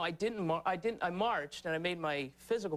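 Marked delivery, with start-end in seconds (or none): none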